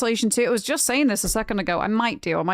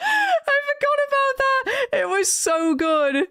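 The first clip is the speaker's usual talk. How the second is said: laughing; slower than usual. Real, not acted. Laughing after ad quote.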